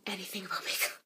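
soft embarrassed whisper. Real, not acted. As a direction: whisper it like a confession. Embarrassed confession.

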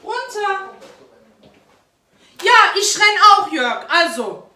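hohe, laute Ammensprache; Kleinkindsprache. Real, not acted. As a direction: ruf singend durchs Haus. Mutter ruft Kind und Mann. (hoch und laut rufend)

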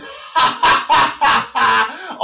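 big, high burst of laughter; loud laugh. Shown as a demonstration. Teacher showing what laughing out loud sounds like. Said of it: "you laugh out loud".

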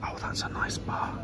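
hushed whisper in a quiet lounge. Real, not acted. Vlogger in a silent lounge, whispering. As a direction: keep it barely audible. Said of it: "Why am I whispering?".

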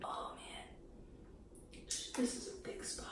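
soft whispered dismay; dreading whisper. Real, not acted. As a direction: whisper it, dreading the next strip. Dreading a hairy spot.

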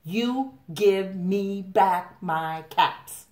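finger-wagging scolding. A narrator's reading. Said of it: "shaking a finger at them".

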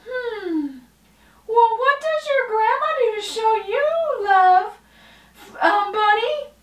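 high, fast, bubbly puppet voice; high puppet girl. Acted. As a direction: chirp it like a perky little puppet.

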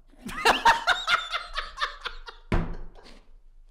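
high, breathy cackle; real laughing fit. Real, not acted. Host cracks up that co-host doesn't know, helpless laughter.

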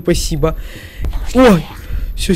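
ordinary talk

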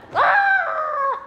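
high, loud sudden cry; startled shout. Real, not acted. Streamer suddenly startled mid-stream, shouting. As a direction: jump at something unexpected.

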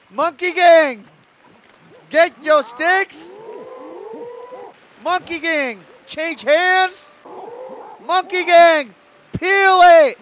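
barking drill sergeant orders. Acted. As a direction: shout commands at the troops. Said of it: "Sly sounding like a drill sergeant?".

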